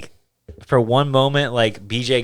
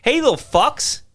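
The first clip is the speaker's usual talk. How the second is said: high, loud pretend yell at teenagers; imagined telling off rowdy teenagers, loud; mock yell at kids; 11 semitones higher, 5 dB louder than usual. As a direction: shoo the kids off, half joking. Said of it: "tell these kids".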